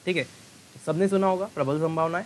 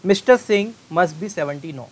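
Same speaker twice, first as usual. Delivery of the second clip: slow, loud example read; slow dictation; 3 semitones higher, 6 dB louder, much slower than usual. Shown as a demonstration. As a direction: read it out slowly to students. Teacher reads example sentence.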